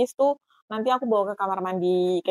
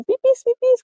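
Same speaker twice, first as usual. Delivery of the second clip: high-pitched, louder mock toddler call; 7 dB louder than usual. Toddler telling mom about needing the toilet, high urgent imitation. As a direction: imitate a little child's high, loud cry. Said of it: "dia tetap sih ngomong".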